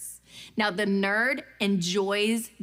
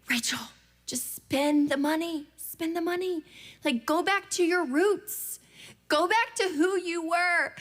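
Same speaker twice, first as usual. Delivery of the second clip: inner pep talk; 7 semitones higher than usual. Retold or imitated. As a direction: egg yourself on to splurge. Inner voice urging her on.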